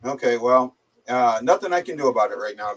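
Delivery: normal talking voice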